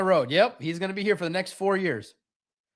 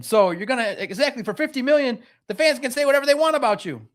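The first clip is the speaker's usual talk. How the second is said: ordinary talk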